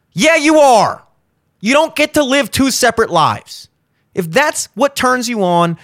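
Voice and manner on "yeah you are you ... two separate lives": sharp retort. Real, not acted. Retort to imagined excuse.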